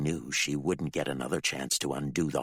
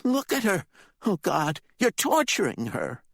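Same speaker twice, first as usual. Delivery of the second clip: much higher anguished plea; appalled, pleading; horrified boy; 14 semitones higher, 4 dB louder than usual. Acted. Ricky pleads over Candy, anguished.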